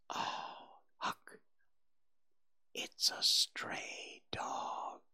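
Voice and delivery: slow, soft, horrified whisper; barely audible whisper. A narrator's reading. Tom tells Huck of the stray dog, whispering. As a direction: whisper it slowly, hardly audible. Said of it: "His whisper was hardly audible".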